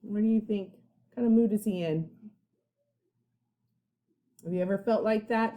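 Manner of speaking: ordinary delivery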